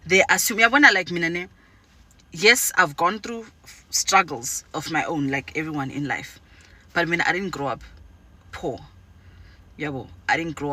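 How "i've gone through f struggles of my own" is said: normal talking voice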